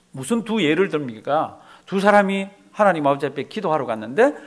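normal talking voice

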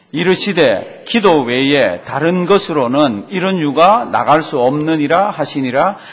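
loud, slow unison reading; group scripture reading. Real, not acted. Congregation reading Jesus' answer aloud together. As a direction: read it together, firm and loud.

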